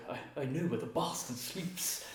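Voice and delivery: slow, lightly voiced Keanu; a hint of a brooding Keanu Reeves; slow mock movie-hero line; Keanu Reeves impression. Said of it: "Keanu Reeves, like".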